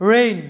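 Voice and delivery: রেইন repeated, silent g; careful model of রেইন; রেইন, one syllable; English word reign. Shown as a demonstration. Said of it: "লক্ষ্য করো".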